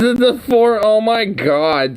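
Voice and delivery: high, exasperated outburst; exasperated disbelief. Real, not acted. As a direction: cry out as if you can't believe that excuse. Exasperated by the official's excuse.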